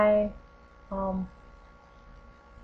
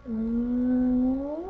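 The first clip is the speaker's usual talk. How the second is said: kiss sound. Real, not acted. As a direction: blow a kiss with a smack. Blowing a kiss.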